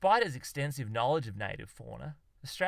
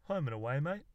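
hesitant answer with a questioning rise; tentative guess; 2 semitones higher than usual. A narrator's reading. Poet guesses under pressure, tentative. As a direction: venture it, unsure it is right. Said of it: "ventured a guess".

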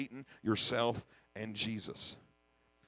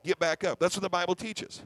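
ordinary talk

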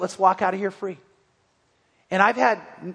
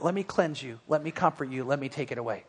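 tender; 5 semitones lower, 8 dB quieter than usual. Acted. God's voice offering comfort.

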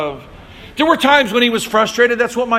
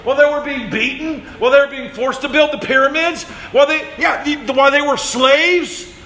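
usual speaking voice

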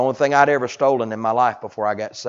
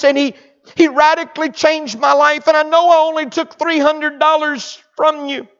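repentant son; 10 dB louder than usual. Acted. Zacchaeus voiced pleading to mother.

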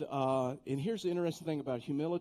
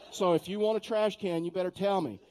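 mock teasing demand; 4 semitones higher, 6 dB louder than usual. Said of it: "And I'm like".

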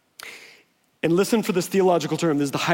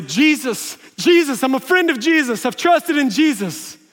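loud, slightly higher urgent plea; earnest pleading; 6 semitones higher, 4 dB louder than usual. Pretend. Believer before God's wrath, pleading loud and urgent. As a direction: beg as if your life depends on it.

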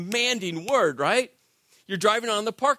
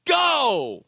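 loud, higher "Go!" at the stoplight; mock driver's yell; shouted go; 5 semitones higher, 5 dB louder than usual. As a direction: yell "Go!" at the car ahead. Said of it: "Then what do you scream".

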